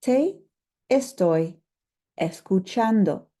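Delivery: te, estoy, escuchando with a pause after each word; careful model phrase, one word at a time. Shown as a demonstration. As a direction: break it into single words, slowly. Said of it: "goes all the way at the front".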